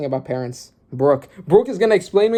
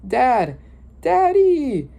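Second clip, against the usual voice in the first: put-on high, louder rising child's cry; child crying for dad; 11 semitones higher, 3 dB louder, much slower than usual. The child calling to his dying father, high and pleading. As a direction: call out like a desperate kid.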